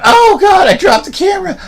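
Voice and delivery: high shouted mock panic over a dropped camera. Vlogger mocking himself dropping the camera, high hammed-up shout. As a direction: ham up the horror of the fumble. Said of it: "But I'm like".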